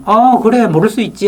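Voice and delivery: 높은 음으로 너그럽게; 흔쾌히 받아주듯 밝게. Retold or imitated. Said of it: "기분 좋게 설명을 해주더라고요". (남편에게 답하는 아내, 높은 음으로 흔쾌히)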